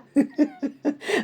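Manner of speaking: laugh. Real, not acted. Laugh while mocking.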